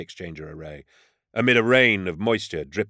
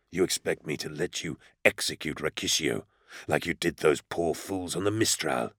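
low, hissed fury; angry hiss; 3 semitones lower, 6 dB quieter than usual. A narrator's reading. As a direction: hiss it through your anger. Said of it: "his words were an angry hiss".

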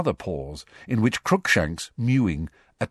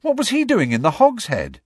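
high, loud astonished question; stunned; 6 dB louder than usual. A narrator's reading. Boy questioning his godfather, stunned. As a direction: blurt it out in high-pitched disbelief. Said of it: "said, stunned".

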